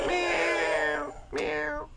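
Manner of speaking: high, squeaky play-cat meows; dad playing Grumpy Cat with high mewing. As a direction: meow up high like a little cat for the kids. Dad voices Grumpy Cat mask.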